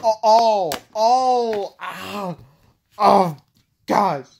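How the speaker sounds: high, loud pained oh's; shocked wincing. Real, not acted. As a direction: wince at a brutal hit. Brutal hit seen in game.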